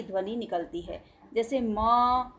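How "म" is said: म stretched slowly so the hidden अ sound is heard; म said slowly with the अ sound trailing after it; drawn-out demo sound. Shown as a demonstration. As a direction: hold म long to show its inherent अ. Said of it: "तो पीछे सुनाई दे रहा है".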